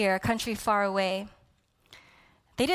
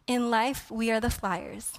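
normal talking voice

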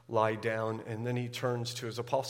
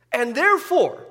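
higher and louder, fervent climax; impassioned preaching; 9 semitones higher, 5 dB louder than usual. Acted. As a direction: proclaim it with rising fervour. Climax of sermon on peace.

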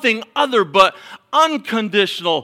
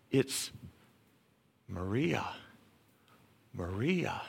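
gently calling a name, very soft; 9 semitones lower, 16 dB quieter, slower than usual. Acted. God quietly calling someone's name, very soft. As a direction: call the name like a quiet inner voice.

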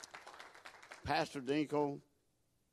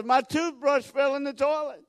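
high, loud imitation of an upset boy; hollering child; 10 semitones higher, 6 dB louder than usual. Little boy tells mama about his dropped toothbrush, hollering. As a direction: holler it out like a kid in a panic. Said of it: "he starts hollering".

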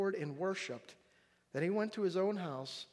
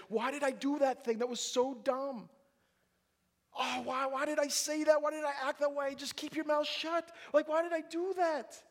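frustrated self-reproach; 8 semitones higher than usual. Retold or imitated. Sinner in a prison cell, frantically scolding himself. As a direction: berate yourself in a frantic whine. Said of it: "you're saying".

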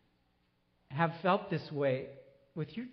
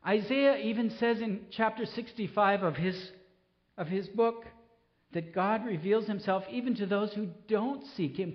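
usual speaking voice

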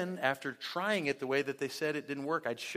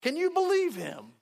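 louder, higher indignant huff on someone else's behalf; mock outrage; 4 semitones higher, 8 dB louder than usual. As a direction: get worked up over a friend's grudge. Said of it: "they're mad for you".

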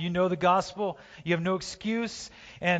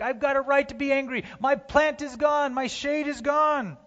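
whiny Jonah; 9 semitones higher than usual. Acted. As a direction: sulk like a spoiled prophet. Jonah voiced complaining about plant.

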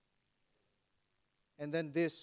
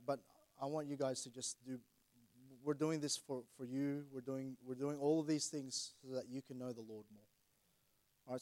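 ordinary delivery

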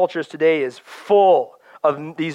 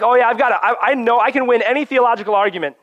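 a loud, higher, smug mock know-it-all; hammed-up smug theologian; 6 semitones higher, 5 dB louder, faster than usual. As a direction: crow it like someone who wins every argument. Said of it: "You're gonna become cold-hearted".

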